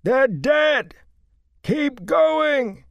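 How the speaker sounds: very loud, high panicked yell; frantic bellow. A narrator's reading. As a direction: shout the horror across the road. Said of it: "Matt bellowed".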